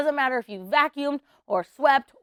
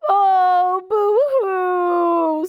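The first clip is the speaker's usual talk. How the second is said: hammed-up boo-hoo wail, higher and louder; mock crying; 7 semitones higher, 6 dB louder, much slower than usual. Shown as a demonstration. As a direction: fake a big cartoon sob for the kids. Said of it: "everyone's gotta cry like Hagar".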